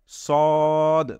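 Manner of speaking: "সদ" with a long, level rounded vowel; careful pronunciation. Shown as a demonstration. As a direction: hold the middle of "সদ" slowly and steadily. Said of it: "সুন্দরভাবে উচ্চারণ করার চেষ্টা করব".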